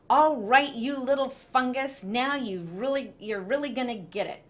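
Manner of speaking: big brother threatening revenge; angry threat. A narrator's reading.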